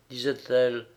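usual speaking voice

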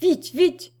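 high-pitched hurried-sounding command; urgent order. A narrator's reading. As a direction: call for help urgently. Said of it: "dit alors le régent".

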